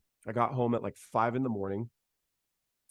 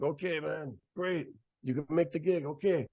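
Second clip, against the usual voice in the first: higher, lively voice of the bandleader on the phone; imitating Arturo; 6 semitones higher than usual. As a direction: lightly play the excited bandleader.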